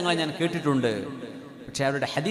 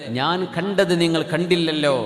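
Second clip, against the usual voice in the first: ordinary talk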